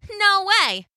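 high cheeky refusal; cocky little cookie voice; gingerbread man. A narrator's reading. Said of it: "said the gingerbread man".

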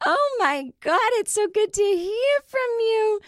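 high, loud gushing mom voice; imitating mom. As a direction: gush it out like a thrilled elderly mother. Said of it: "she was like".

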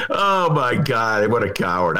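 normal talking voice

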